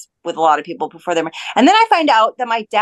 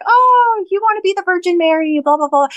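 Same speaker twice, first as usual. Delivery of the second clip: mocking teasing; 10 semitones higher, 3 dB louder than usual. Friends teasing her for being a virgin, mocking. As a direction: imitate friends making fun, pitched well up. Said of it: "laughing at me and they're like".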